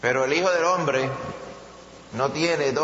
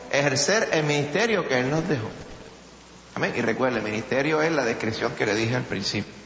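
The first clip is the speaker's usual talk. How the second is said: ordinary talk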